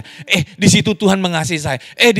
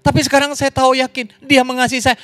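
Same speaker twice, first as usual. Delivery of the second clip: fervent preaching; 6 semitones higher than usual. Acted. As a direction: testify with rising fervour. Preaching climax, raised voice.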